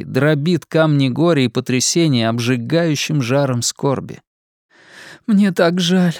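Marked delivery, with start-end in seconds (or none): 5.3-6.1 high, halting, tearful woman; a grieving neighbour near tears; tearful Missy. Acted. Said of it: "сбивчиво говорила Мисси".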